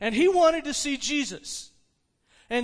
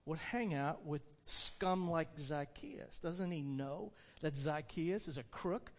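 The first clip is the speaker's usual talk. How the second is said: low, soft complaint about someone; grumbling; 5 semitones lower, 9 dB quieter than usual. Acted. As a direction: grumble disapprovingly under your voice. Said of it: "They were grumbling, complaining that".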